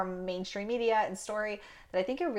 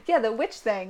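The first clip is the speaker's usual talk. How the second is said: normal talking voice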